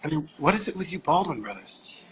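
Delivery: soft, hazy director impression; quiet mock stoner puzzlement; stoned director. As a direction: imitate a stoned director, softly musing. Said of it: "little stoner smile on his face".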